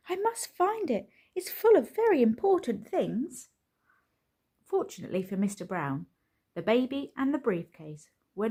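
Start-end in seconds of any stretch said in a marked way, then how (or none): 0.1-3.5 much higher, urgent fretting; high anxious fluster; worried. A narrator's reading. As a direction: fret aloud, frantic to find it. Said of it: "he said".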